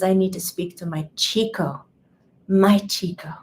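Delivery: imitated caller with a Spanish accent. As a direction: lightly put on a Spanish accent. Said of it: "a Spanish accent".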